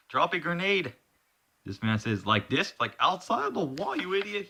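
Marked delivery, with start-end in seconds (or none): 0.1-0.9 urgent order. Acted. Acted urgent order in battle. 2.3-4.5 high, exasperated comeback; squeaky indignant jab at an idiot; mocking retort. Acted bickering during the fight.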